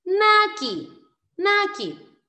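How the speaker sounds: MAKI high and loud, MA held, KI falling; syllable demonstration. Shown as a demonstration. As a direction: model MA-KI slowly for learners. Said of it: "Por ejemplo".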